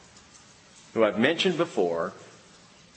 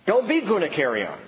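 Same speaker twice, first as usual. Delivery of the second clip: usual speaking voice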